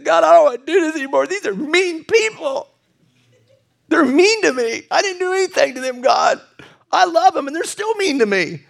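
high-pitched mock complaint; hammed-up whining. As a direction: whine to God like a wronged child. Acts out own complaint to God.

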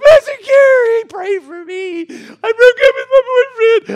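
put-on high, loud, slow wailing girl's voice; crying teen girl. Girl in the van begging her pastor to pray, wailing. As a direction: sob it out like a heartbroken teenager. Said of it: "a little girl in the back is going".

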